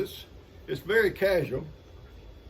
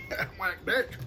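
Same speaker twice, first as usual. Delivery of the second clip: quick words through a laugh; 6 semitones higher, 6 dB quieter, faster than usual. Real, not acted. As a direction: laugh it out fast and breathy. Laughing at own plan.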